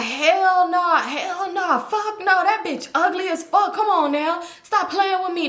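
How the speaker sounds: mock his indignant dismissal; retold trash talk. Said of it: "he talking shit".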